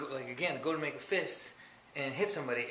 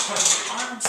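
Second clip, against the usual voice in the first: effortful; 6 semitones higher, 10 dB louder than usual. Shown as a demonstration. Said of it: "show you two different ways".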